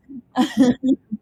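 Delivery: loud laugh; real laugh. Real, not acted. Host laughing along with the guest, loud real laugh.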